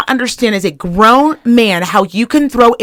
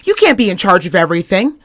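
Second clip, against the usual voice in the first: ordinary talk, nothing marked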